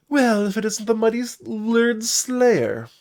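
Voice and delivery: high, loud storybook bard voice; high-pitched, winking bard; playful bard greeting. Acted. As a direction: hail a hero with a high, theatrical flourish.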